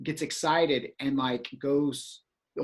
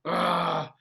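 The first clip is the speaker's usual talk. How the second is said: retold "ugh" of frustration; acted-out groan; exasperated groan; 2 semitones higher than usual. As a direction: groan as if the trick just collapsed. Retelling his private frustration.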